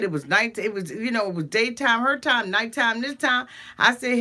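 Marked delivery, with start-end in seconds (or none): none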